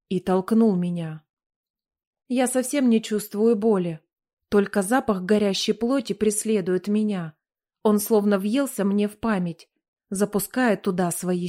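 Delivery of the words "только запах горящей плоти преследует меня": ordinary delivery, nothing marked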